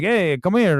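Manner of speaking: lightly mocked House of Gucci Italian accent; Adam Driver accent. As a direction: put on a wandering movie-Italian accent. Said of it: "like Adam Driver in any movie where he does an accent".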